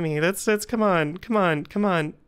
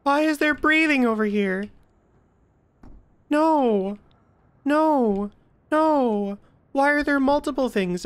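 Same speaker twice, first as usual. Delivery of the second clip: higher, louder alarmed questions; spooked; 5 semitones higher, 3 dB louder than usual. Real, not acted. Streamer spooked by breathing in a horror game, higher and louder. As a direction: recoil at each new scare.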